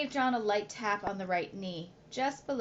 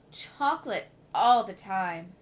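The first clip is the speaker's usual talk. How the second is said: louder, every word pressed down; emphatic reply; 6 dB louder than usual. Acted. Boy answering his father about chocolate, louder and emphatic. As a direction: insist on it, no doubt at all. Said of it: "John replied emphatically".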